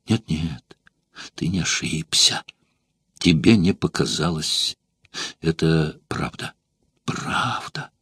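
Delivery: soft broken whisper through tears; barely voiced, crying as she admits it; tearful. Weeping courtesan confirming the betrayal, hushed and tearful. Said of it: "утирая горькие слёзы".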